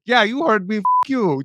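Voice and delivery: high, imitated scammer taunt; mock defiance. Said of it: "And they're like".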